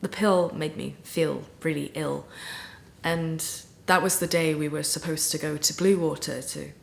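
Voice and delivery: low, soft, subdued telling; guilty confession. Acted. Confessing a lie to best friend, subdued and guilty. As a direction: own up quietly, with the voice sunk low.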